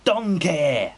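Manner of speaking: loud, high Scottish-accented call; Shrek. Shown as a demonstration. Showing a friend a Scottish accent, loud cartoon-ogre impression. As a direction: bellow it in an ogre's Scottish accent. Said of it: "just do a Shrek accent".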